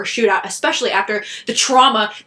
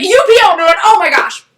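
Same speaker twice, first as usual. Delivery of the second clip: louder and higher, thrilled shout of praise; excited cheer; 9 semitones higher, 8 dB louder than usual. Real, not acted. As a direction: cheer the goalie like you're still in the arena. Cheering the goalie's shootout save.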